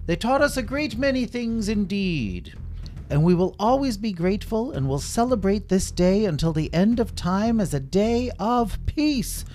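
high, grand royal voice; stately king. Acted. Red King promising to celebrate peace, grand high voice. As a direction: proclaim it like a king. King's closing speech.

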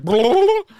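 shrieked nonsense blah, high and loud; mock yell. Buffy shocking Angel in his dream, a loud high shriek. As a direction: blurt it out in a loud high yelp. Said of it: "he's like".

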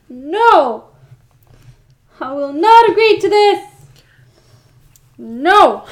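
loud, high protest; outraged refusal. Real, not acted. Listener refusing to agree to this, loud outrage. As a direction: shout it down in horrified refusal.

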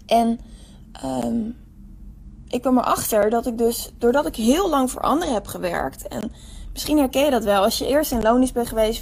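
ordinary talk

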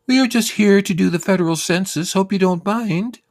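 high, bright-pitched sugary lady voice; census lady voice. Retold or imitated. Census woman greeting the stoned man, very high and sweet. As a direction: chirp it way up like a cheery official.

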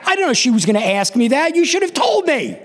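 put-on high, rising kid's complaint; acted-out whining blame, climbing in pitch; indignant protest. Child blaming dad, caught out.

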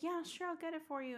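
high, soft, airy promise; breezy mom. Retold or imitated. Mom promising a birthday pet, breezy. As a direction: lightly imitate a mother agreeing too easily.

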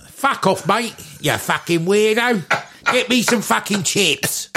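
put-on Essex accent.

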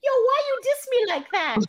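very high Mickey voice; [name]. Acted. [name] answering his bandmate, high Mickey voice. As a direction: protest in a piping cartoon squeak. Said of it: "I give [name] the high-pitched Mickey voice".

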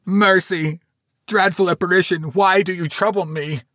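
high, loud frightened cry for mercy; terrified plea. A narrator's reading. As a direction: beg in panic on your knees. Scrooge on knees, terrified.

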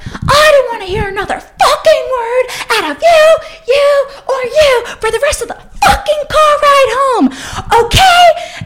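very high, loud screamed rant; furious outburst. Retold or imitated. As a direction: scream at them like you've completely had it. Fed up, yells at the guys.

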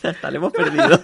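high, laughing voice; real giggles breaking into the words; through laughter. Real, not acted. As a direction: say it while cracking up. Hosts cracking up after clip.

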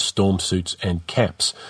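ordinary delivery, nothing marked

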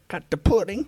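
high, goofy catchphrase impression; Bill Cosby. Shown as a demonstration. Friend tries the pudding line after a bad attempt, Cosby voice. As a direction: lift the catchphrase into a silly high voice. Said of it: "can you do a Cosby impression".

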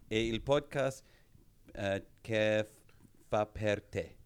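a hint of Italian, halting and hammy; Italian accent. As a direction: fake your way through in Italian. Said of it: "I'm thanking One Eye Tiger".

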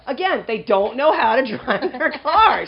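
loud, drawn-out exasperation; slow, high, emphatic complaint; exasperated rant. Real, not acted. Hammed-up rant about bad drivers.

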